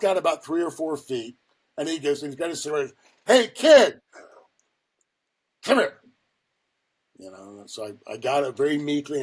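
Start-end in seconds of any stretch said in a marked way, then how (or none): 3.2-6.0 high, loud summons across the office; retold shouted order; calling out brusquely. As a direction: imitate a gruff boss hollering for the rookie. Boss calls kid from doorway.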